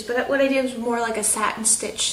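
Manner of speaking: usual speaking voice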